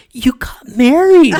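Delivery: high, loud, slow stage squeal; imitating an excited coworker's hushed shriek; failed attempt at quiet. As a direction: try to keep a secret and squeal it anyway. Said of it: "she's trying to be quiet about it".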